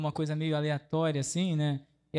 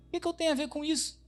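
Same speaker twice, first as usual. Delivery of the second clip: quick, much higher questioning "O que que eu tenho a ver com isso"; 10 semitones higher, faster than usual. Shown as a demonstration. Teacher showing how Jesus may have questioned Mary, quick and high. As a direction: toss it off fast and high, like a puzzled question. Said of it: "Depende da entonação que a gente lê".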